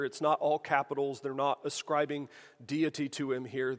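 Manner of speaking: usual speaking voice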